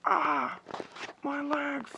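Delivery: long sigh then high cry of pain. Acted. Alexis shot in the legs, high pained cry. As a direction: groan then cry out about her legs.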